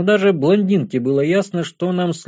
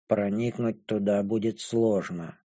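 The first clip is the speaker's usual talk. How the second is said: low, quiet, slow remark; dull, muffled; 6 semitones lower, 9 dB quieter than usual. A narrator's reading. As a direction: let it drop softly in a muted voice. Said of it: "глухо обронила Бесса".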